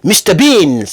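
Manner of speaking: ordinary talk, nothing marked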